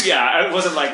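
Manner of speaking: usual speaking voice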